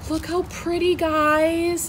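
high-pitched admiring coo; silly cooing voice. Real, not acted. Showing viewers a gift plant, high silly cooing. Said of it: "talking like this in this video".

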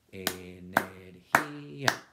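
energía split slowly into e-ner-gí-a; syllable by syllable. Shown as a demonstration. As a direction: count the beats of energía slowly. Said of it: "Cuatro golpes de voz".

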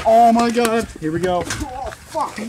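yelling from pepper heat; loud pained outburst. Real, not acted. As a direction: cry out, mouth on fire. Biting hot pepper, loud reaction.

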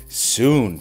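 ordinary delivery

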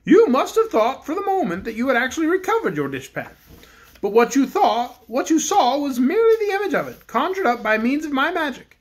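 the Bear King addresses the cook; kindly Bear King. Acted. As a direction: speak as a gentle storybook king.

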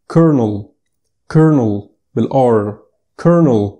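كولونيل said "KER-nel", with an r and no l; كولونيل sounding just like kernel; correct English pronunciation. Shown as a demonstration. Teacher modelling colonel, a firm clear demo. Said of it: "مش كولونيل".

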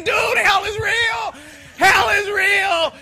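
high, loud, slow desperate shouting; frantic urgent shout. Retold or imitated. Man grabbing a cop, frantic shouts about hell. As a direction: grab him and shout the warning. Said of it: "I'm grabbing the cop and I'm saying".

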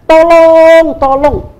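calling out for help, loud and high; cri à l'aide. Acted. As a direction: shout for help from the cliff edge. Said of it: "Dia mulai teriak".

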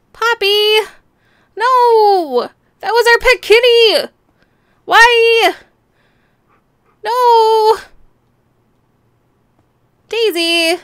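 real shocked yelling; anguished shout. Real, not acted. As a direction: scream it in disbelief. Dogs just killed pet cat.

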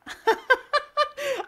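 high laugh of disbelief; a giddy burst of laughter; high delighted laugh. Real, not acted. Reacting to the Charizard, a high delighted laugh. Shocked by the pull.

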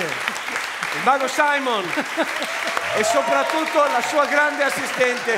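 high, slow, booming stage introduction; showman announcer. Acted. Announcer hailing the magician and his assistant, slow and grand. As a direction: present the magic act to the crowd.

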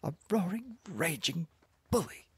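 high-pitched, word-by-word scolding; indignant wife. A narrator's reading. Wife denouncing her husband, high and outraged. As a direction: spit out the accusation in high pitch.

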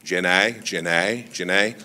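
low, soft practice run at a Southern simpleton; Forrest Gump. Acted. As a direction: try out Forrest's voice, quietly. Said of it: "I gotta work it".

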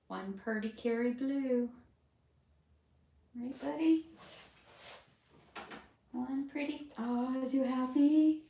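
high, slow pet voice. Real, not acted. Groomer cooing to the finished poodle in baby talk. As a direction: coo at the dog.

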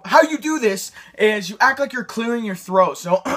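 ordinary talk, nothing marked

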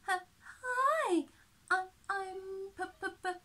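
high, tearful stammer; a sob breaking through the name; crying stammer. A narrator's reading. Penny greets the pigs, stammering through tears. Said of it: "Penny cries".

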